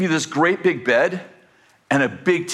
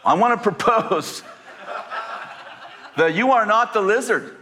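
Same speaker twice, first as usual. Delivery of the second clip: higher, raised preaching voice; 6 semitones higher than usual. Acted. Pastor exhorting the church, higher preaching voice. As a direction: preach it with conviction.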